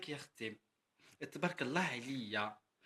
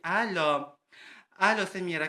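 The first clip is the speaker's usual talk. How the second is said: loud, higher hello down the phone line; calling on the phone; 3 semitones higher, 6 dB louder, slower than usual. Retold or imitated. Calling her friend Samira the cook on the phone, loud hello.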